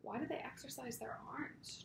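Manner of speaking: a soft unvoiced whisper to himself; whispered thought. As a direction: whisper it like a secret worry. Jack's private wondering.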